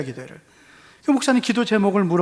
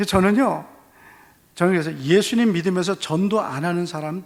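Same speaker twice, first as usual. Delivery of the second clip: normal talking voice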